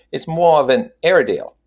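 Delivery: high, loud hawker selling puppies; street dog seller. A narrator's reading. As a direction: pitch the seller up, pushing a sale.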